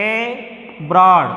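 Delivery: "abroad" split slowly into two separate syllables; teacher sounding out "abroad" in two pieces. Shown as a demonstration. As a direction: pause between the first and second parts of "abroad". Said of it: "B పైన వస్తుంది".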